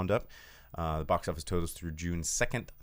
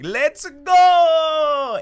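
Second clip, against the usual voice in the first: put-on high, loud Italian-accented shout; Mario shout; 14 dB louder than usual. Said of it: "trying to ham it up or be Mario".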